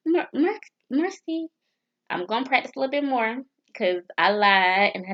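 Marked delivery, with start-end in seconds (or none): none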